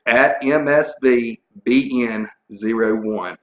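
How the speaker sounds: slow, spaced-out spelling of the handle. Real, not acted. Co-host repeating the Twitter handle on request, slowly. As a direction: spell out M S V B N zero one slowly for listeners. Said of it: "You said it too fast".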